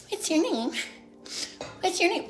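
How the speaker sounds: high, soft flirty voice; high flirty pickup voice at the bar. Retold or imitated. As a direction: put on a sweet flirty voice. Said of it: "I do my little, which I love".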